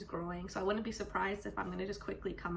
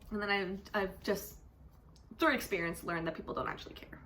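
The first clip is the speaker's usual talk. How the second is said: usual speaking voice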